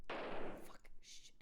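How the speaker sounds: breathless hushed swearing, no voice; whispered curses. Real, not acted. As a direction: swear quietly so chat barely hears. Cursing under breath at game.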